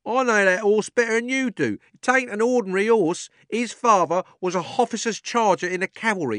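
high, loud rough cabman calling out; cockney cabby shouting. A narrator's reading. Cabby shouts over the crowd at the policeman, high and loud. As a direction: bellow at the policeman like a London cabby. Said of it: "said the cabby".

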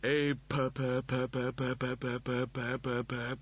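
mechanical stuck-loop repetition; robotic stammer. A narrator's reading. Mechanoid seized up facing death, stammering. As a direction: repeat the syllable like a jammed machine. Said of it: "a kind of body stammer".

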